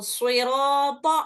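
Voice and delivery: सिराता said slowly, its रा vowel held for two counts; drawn-out recitation. Shown as a demonstration. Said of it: "इसको खींचना है सिर्फ दो हरकत". (रा को दो हरकत खींचकर)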